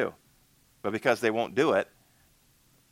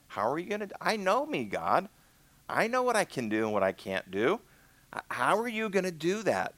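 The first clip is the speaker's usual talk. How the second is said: incredulous protest; 9 semitones higher than usual. Acted. Moses doubting how God could use him, incredulous protest. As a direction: protest in a high, baffled voice.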